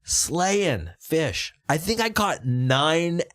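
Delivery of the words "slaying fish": slowly drawn, high boast. Acted. Bragging to friends about his catch, slow. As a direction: stretch the words out proudly.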